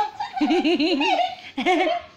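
a long run of giggles; giggling laugh. Real, not acted. Cười khi chơi với chó. (cười khúc khích một tràng)

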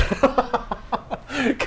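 a long, high, sheepish laugh; embarrassed laugh. Real, not acted.

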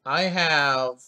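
ordinary talk, nothing marked

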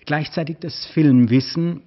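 plain reading voice

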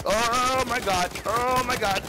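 high-pitched panicked exclamation. Real, not acted. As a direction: draw it out high, in alarm. Sudden panic during gameplay.

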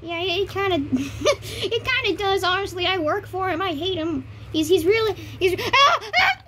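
high squeaky chipmunk voice. Acted. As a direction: talk like a sped-up chipmunk. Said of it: "I sound like a chipmunk".

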